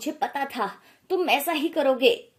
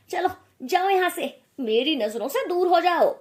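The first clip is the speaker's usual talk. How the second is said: higher, louder, furious get out; angry dismissal; 5 semitones higher, 4 dB louder than usual. Acted. Mother-in-law ordering the bride out of her sight, angry. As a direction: drive her away in anger.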